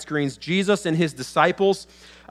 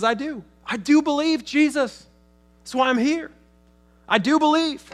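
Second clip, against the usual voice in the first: pleading cry; 10 semitones higher than usual. Acted. Father pleads with Jesus.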